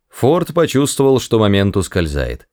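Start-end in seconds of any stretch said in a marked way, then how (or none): none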